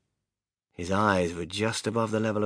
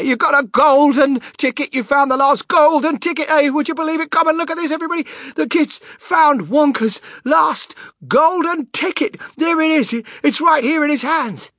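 ecstatic yelling; 9 dB louder than usual. Acted. Shopkeeper yelling with excitement.

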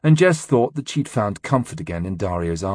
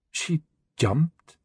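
puzzled; 6 semitones higher than usual. Acted. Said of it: "Kalila's voice sounded puzzled".

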